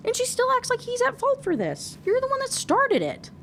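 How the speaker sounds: way up high, outraged and incredulous; exasperated squeal. Real, not acted. Reader scolding the heroine, in an outraged high squeal.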